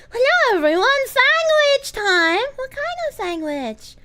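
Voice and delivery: high, put-on goofy voice; a goofy cartoonish character voice; silly voice. Streamer clowning for chat in a high silly voice.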